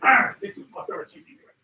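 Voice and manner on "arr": loud imitated lion roar; lion growl. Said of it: "The lion's gonna be like".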